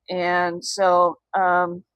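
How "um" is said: ordinary talk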